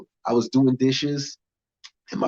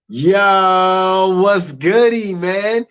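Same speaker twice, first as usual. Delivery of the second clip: loud, high hype opener; hype greeting; 6 semitones higher, 5 dB louder, much slower than usual. Real, not acted. Comic greeting the crowd, loud and high. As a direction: hype the crowd as you walk on.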